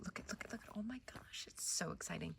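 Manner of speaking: breathless whisper of delight; excited whisper. Real, not acted. Gardener whispering over dahlia buds, thrilled hush. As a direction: whisper it as if the buds might hear.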